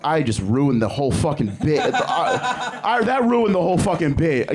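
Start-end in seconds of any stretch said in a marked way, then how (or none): 1.9-4.6 high, giggling through the words; laughing while admitting the bit fell apart; laughing at himself. Real, not acted. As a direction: crack up at your own blown joke.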